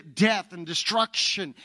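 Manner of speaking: ordinary delivery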